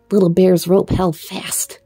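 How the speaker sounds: usual speaking voice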